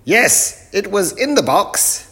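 loud, very high professor voice; muddled professor. Acted. Professor mishears the greeting, answers loud and high. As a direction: boom it out high, quite sure of yourself. Professor triumphantly finds the fuse.

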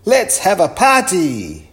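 high, loud festive shout; rally the crowd to celebrate, loudly; shouted cheer. A narrator's reading. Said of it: "shouted the people".

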